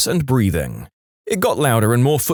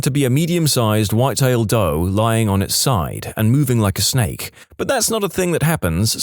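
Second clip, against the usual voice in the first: usual speaking voice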